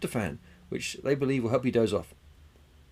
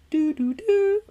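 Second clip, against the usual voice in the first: high-pitched "do, do, do" jingle notes; imitated xylophone sting; 6 dB louder, much slower than usual. Host mimics a xylophone he doesn't have, high sung notes. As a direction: sound out a little jingle, high and loud.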